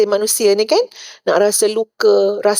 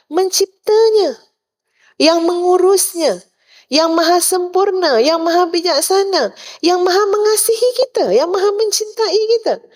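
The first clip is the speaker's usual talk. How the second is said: rising higher with each name of God, preaching fervour; building praise; 8 semitones higher than usual. Acted. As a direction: lift each line higher in mounting praise. Preacher praising God's attributes. (nada pujian yang semakin tinggi)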